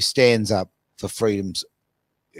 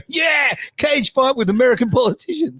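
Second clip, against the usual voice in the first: excited. Retold or imitated. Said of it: "No, you go".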